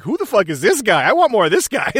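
high, loud mock amazement; acted excited reaction. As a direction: voice an instantly hooked viewer, high and loud. Voicing a viewer's instant reaction.

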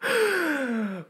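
sharp high gasp; shocked gasp. Real, not acted. Shocked by a dirty joke.

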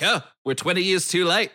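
quick put-on Aussie voice; Australian accent. Imitating Australians late to the movie business, Australian accent. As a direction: rattle it off in a mock Australian accent. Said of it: "look at that Australian".

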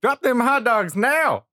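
high-pitched holler that climbs at the end; yelling in pursuit. Acted. Camper chases wood booger through the woods, indignant yelling. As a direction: yell after a fleeing thief. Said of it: "Stephen yelled as he chased".

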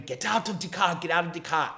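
half-shouted order in a put-on accent; mock screaming. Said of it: "He's screaming".

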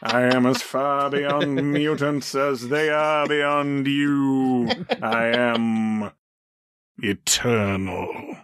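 slow grandiose villain, drawn out; Apocalypse voice. Retold or imitated. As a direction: proclaim your own godhood slowly. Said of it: "some [name] voices on this podcast".

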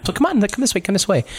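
high, hurrying guide voice; guide calling the party along. Acted. Theo leading the party out of the cafeteria, high beckoning call. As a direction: usher them along in a high voice.

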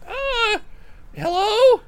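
high-pitched, just-woken little halfling; sleepy halfling. Acted. Halfling Jorik walks in rubbing his eyes, sleepy. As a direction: squeak a groggy greeting in a high voice. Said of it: "he's rubbing his eyes sleepily and walks in".

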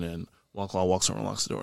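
ordinary talk

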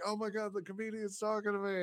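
high, hushed starstruck squeal, retold. Audience member thrilled the comedian is talking to them, starstruck. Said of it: "I'm just in tears".

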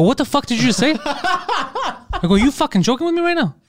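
high, laughing retold outburst; incredulous laughing squawk at a coworker; laughing disbelief.

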